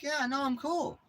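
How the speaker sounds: high, sly voice for the other dog; mock the dog playing innocent; imitating the dog. Voicing the pit bull pretending to be friendly, sly and high. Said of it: "he's like".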